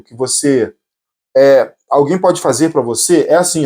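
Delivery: normal talking voice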